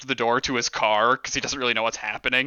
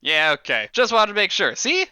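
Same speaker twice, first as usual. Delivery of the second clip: pleased boss; 6 semitones higher than usual. Acted. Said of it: "boss praises Gregory".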